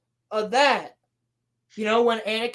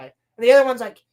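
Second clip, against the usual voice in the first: ordinary talk, nothing marked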